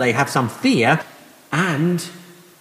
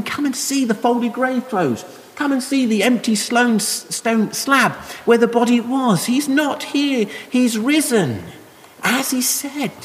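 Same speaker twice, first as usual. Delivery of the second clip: gentle invitation; 7 semitones higher, slower than usual. Retold or imitated. Angel's invitation voiced higher.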